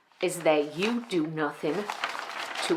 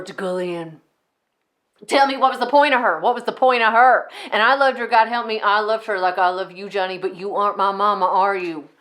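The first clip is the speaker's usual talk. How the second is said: loud, insistent interrogation; accusing tirade; 5 dB louder, faster than usual. Acted. Enid turns from Mama onto Johnny, accusing. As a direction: demand answers, turning on your underling. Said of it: "how insane they can sound at times".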